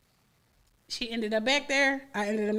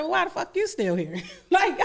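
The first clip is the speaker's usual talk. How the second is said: laughing; 6 semitones higher than usual. Real, not acted. Retelling confronting the friend.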